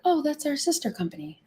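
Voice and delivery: imitated sheepish client. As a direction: lightly mimic a client owning up. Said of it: "they're like".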